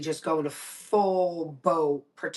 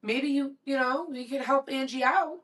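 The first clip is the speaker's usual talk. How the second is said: raised, pointed suggestion; reproachful mother; 6 semitones higher, 3 dB louder than usual. Retold or imitated. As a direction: lightly imitate a mother nudging a useless man. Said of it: "My mother goes".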